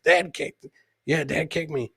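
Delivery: ordinary delivery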